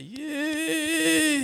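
long, high, loud rising exclamation; hammed-up high holler of disbelief; mock astonished cry. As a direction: imitate a friend's drawn-out squeal of amazement. Friend's astonished cry at his outburst.

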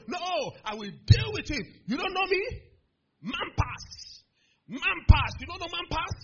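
high, loud acted bluster; threat. Big student challenging him, loud blustering threat. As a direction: mimic a bully throwing his weight around.

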